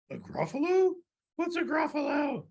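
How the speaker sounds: high bewildered snake voice; puzzled snake. Acted. Snake hears of a Gruffalo from mouse, puzzled and high. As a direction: squeak the question in surprise.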